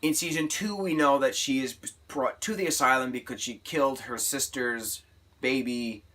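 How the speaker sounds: ordinary talk, nothing marked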